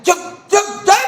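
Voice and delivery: sharp high mock scolding hush; stern hushing. Preacher mimics a mother snapping at her crying baby, high. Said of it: "लेकिन इसे क्या लगता है".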